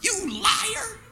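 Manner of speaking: high, softened "caught you" jab; mock accusing. Preacher calls out the pious faker, mock accusing. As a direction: call out the faker in a high, hushed voice. Mocking the fake worshipper.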